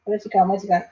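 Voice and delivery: ordinary talk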